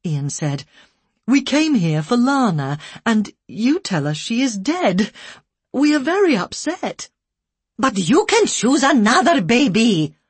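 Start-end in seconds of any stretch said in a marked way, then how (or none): 1.3-7.1 upset protest. A narrator's reading. Husband protests to care worker. 7.8-10.2 loud reproachful broken English. A narrator's reading. Care worker scolding the couple, loud reproachful. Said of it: "as though they were in the wrong".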